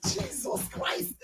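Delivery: slow, hushed disbelief; stunned whisper. Real, not acted. Host stunned by Ruby's answer, whispering. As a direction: breathe it out in awed whisper.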